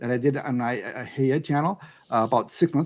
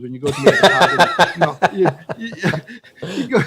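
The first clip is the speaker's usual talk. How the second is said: laughing; 3 semitones higher, 5 dB louder than usual. Real, not acted. Laughing at own joke.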